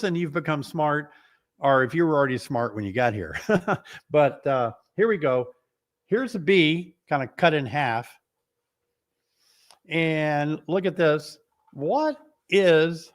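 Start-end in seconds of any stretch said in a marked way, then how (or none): none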